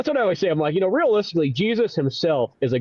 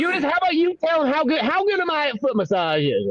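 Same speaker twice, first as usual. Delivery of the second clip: high, bragging character voice; high-pitched hammed-up showing off; Jesus; 11 semitones higher than usual. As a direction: call on a pal to back up your boast. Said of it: "my name is Jesus".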